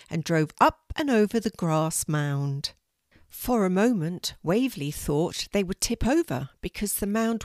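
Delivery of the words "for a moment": plain reading voice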